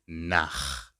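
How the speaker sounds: nach ending in a raspy German ch, like a weak K. Shown as a demonstration. Teacher showing the CH rule, nach with a weak K sound. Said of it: "becomes a weak K".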